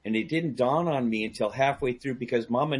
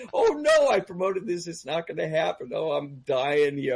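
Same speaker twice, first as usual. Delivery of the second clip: high wail settling into dismay; mock panic; 4 semitones higher than usual. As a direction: act out a comic panic, half-laughing. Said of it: "I'm starting to panic".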